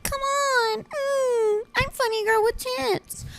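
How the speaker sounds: very high, whiny pleading voice; squeaky mock whine at a friend who says no; whiny begging. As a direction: beg like a spoiled kid.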